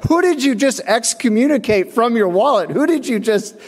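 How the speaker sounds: stunned disbelief at the man; mock outraged disbelief; incredulous. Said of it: "I was like".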